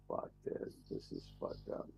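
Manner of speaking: very soft, almost voiceless grumble; retold dejected muttering. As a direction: mutter it to yourself under your breath. Retelling his reaction after the crash.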